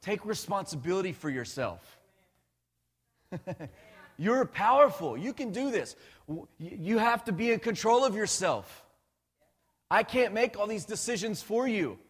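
rally them like a pushy coach dad; lightly voiced no-nonsense dad pep talk; a hint of a firm father. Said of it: "God's like".

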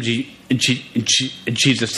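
stuttering on the first sound of Jesus; real stutter. Real, not acted. Stuttering preacher retelling Pilate's judgement, stutter. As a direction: catch on the opening sound, then get the name out. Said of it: "A st-, stuttering guy".

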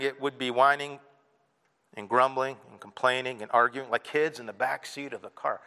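normal talking voice

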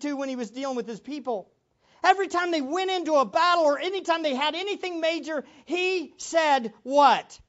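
loud, high, fervent preaching; impassioned sermon cry; preaching at full force. Real, not acted. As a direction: hammer every word to the congregation.

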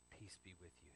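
hushed, low and gentle; calm them very softly; soothing blessing. Acted. Jesus speaking to those who are scared, soothing. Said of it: "He speaks to those who are scared".

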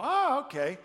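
high, loud impressed "oh, okay"; impressed surprise. Retold or imitated. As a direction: sound suddenly impressed. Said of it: "make you, uh, go".

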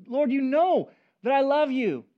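frustrated. Acted. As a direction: push back, hurt at being asked three times. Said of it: "Peter at this time gets frustrated".

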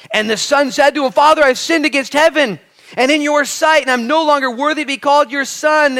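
fiery preacher reading scripture; full-force preaching. Acted. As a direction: proclaim it to the whole church.

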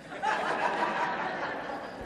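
breathy unvoiced laugh; soft airy laugh. Real, not acted. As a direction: laugh quietly at the memory. Laughing at own story.

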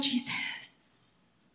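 breathy whisper of the name; whispered exclamation. Retold or imitated. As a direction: whisper it under your breath. Exasperated aside to Jesus in retold exchange.

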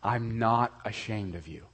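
a quiet, intimate reassurance; voice of Jesus. Retold or imitated. Preacher voices Jesus to the ashamed listener, softly. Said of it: "I want you to hear Jesus Christ saying to you".

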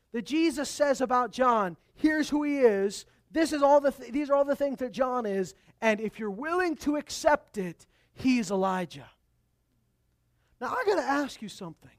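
loud, emphatic sermon reading; preaching scripture. Acted. Pastor reading Jesus' words to the crowd, preaching loudly. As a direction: proclaim each question to the crowd. Preacher building to big point.

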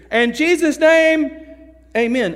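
loud, high, stretched-out prayer ending; oblivious loud reading. Retold or imitated. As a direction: close the prayer far too loud and slow. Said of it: "didn't understand how loud he was talking".

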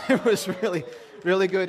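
laughing gush about the food. Real, not acted. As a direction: laugh through it as you gush. Laughing while praising the goat.

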